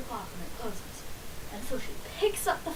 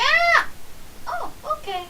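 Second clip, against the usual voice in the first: sudden cheerful switch; 7 dB louder than usual. Retold or imitated. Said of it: "I stopped crying like nothing happened".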